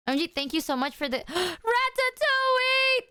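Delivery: high, gasping gratitude; excited thanks. Real, not acted. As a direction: squeal the thanks.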